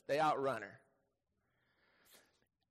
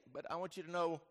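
ordinary delivery

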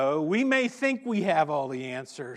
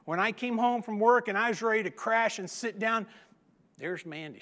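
ordinary delivery

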